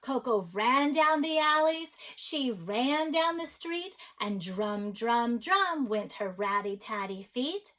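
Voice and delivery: animated, rhythmic storybook narration; story read-aloud. A narrator's reading. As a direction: read to children with big swings of pitch. Reads a picture book aloud.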